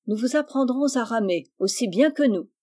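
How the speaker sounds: plain reading voice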